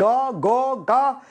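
"قا، قا، قا" as three drawn-out versions of go; vary the vowel on each "قا"; dialect variants. Shown as a demonstration. Teacher shows one word in several dialects, "قا" varied each time. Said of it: "وبتسمع عدة لهجات".

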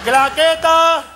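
shouted call. Real, not acted. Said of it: "calls for the clapperboard".

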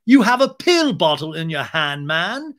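loud, incredulous imitation; James. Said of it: "James is like".

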